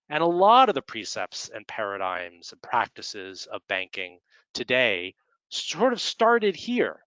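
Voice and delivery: usual speaking voice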